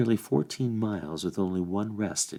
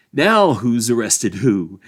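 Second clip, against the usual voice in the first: slow, loud taunting question; a hedger mocks the escort, drawn out; jeering; 3 semitones higher, 10 dB louder, slower than usual. A narrator's reading. Old gaffer taunts the sheriffs, slow and loud. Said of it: "he jeered".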